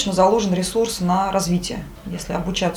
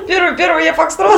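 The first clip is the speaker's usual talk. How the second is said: laughing radio call; 9 semitones higher, 6 dB louder than usual. Pretend. Repeats call sign, laughing.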